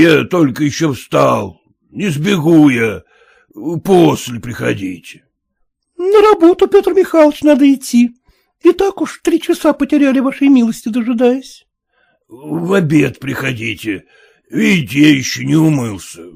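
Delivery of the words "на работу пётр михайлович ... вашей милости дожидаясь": put-on high pleading village girls asking for their pay; high, insistent peasant girls; peasant girls' voice. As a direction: pitch it high like a chorus of girls wanting their money. Girls urge him.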